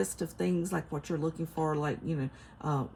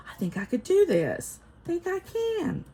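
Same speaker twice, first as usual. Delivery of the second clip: hopeful realization; 12 semitones higher, 3 dB louder than usual. Retold or imitated. Acts out own hopeful thought.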